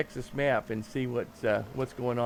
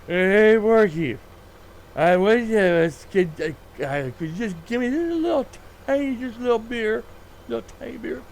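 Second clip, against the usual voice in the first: put-on drunk patron; 8 semitones higher, 6 dB louder than usual. Drunk patron begging the bartender, high and loud. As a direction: ham up the drunk guy pleading for a tiny beer.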